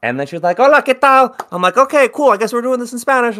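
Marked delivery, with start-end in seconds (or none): 0.6-1.3 high, loud cheery Spanish greeting; high cashier voice. Retold or imitated. 1.8-3.4 high, loud, defeated "okay, cool"; high resigned voice. Retold or imitated. Resigned retold reply.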